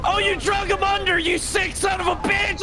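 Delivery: very high, laughing disbelief; shocked laughing shout. Real, not acted. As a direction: shriek at the horror. NPC dragged under train.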